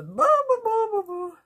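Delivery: très aigu et fort, exaspération chantonnée; lâcher un bon bon bon suraigu d'impatience; bon bon agacé. Real, not acted. Fighting the tangled garland. (exaspérée face à la guirlande emmêlée, voix suraiguë)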